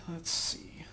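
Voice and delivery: slow whispered murmur; whispering. Real, not acted. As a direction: whisper it while concentrating. Quiet focus on sonar.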